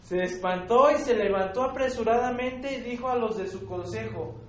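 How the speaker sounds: loud, emphatic Bible reading to the congregation; scripture reading. Acted. Reads Nebuchadnezzar's scripture aloud. (lectura bíblica enfática y fuerte)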